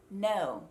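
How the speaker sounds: "no" with an American O; "no" ending with the tongue drawn back. Shown as a demonstration. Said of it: "a U S American O".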